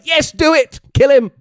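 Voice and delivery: loud, high bloodthirsty cheer. Retold or imitated. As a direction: yell it like a fan egging her on. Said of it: "I was like".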